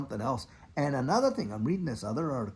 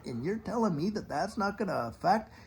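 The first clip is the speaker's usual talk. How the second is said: ordinary talk, nothing marked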